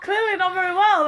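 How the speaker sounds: high, loud mock wail; re-enacted tearful outburst; mock crying. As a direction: repeat it as a hammed-up wail. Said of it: "And I was like this".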